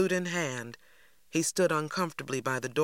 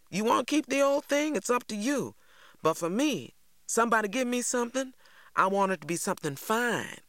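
T J voiced much higher, slick and needling; a sly needling boy talking his friend down; 8 semitones higher than usual. A narrator's reading. T J needling Stacy about keeping the flute.

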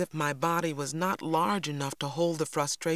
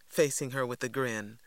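usual speaking voice

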